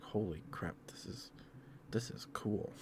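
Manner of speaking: half-whispered retold amazement; hushed awe. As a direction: whisper it softly in wonder. Retold awed reaction to a find.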